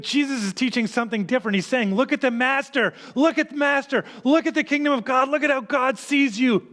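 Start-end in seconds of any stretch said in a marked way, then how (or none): 1.9-6.7 high, pressing call to look; rising preacher's plea; urgent call. Acted. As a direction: lift it up and urge them to look. Preacher voicing Jesus' call.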